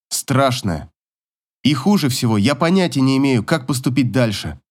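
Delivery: ordinary delivery, nothing marked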